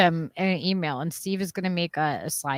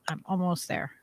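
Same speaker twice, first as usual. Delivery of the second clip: ordinary talk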